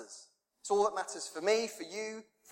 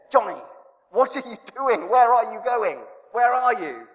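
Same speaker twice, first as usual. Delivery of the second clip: higher, louder call; 6 semitones higher, 7 dB louder than usual. Pretend. God calling out to preacher Johnny, loud and searching.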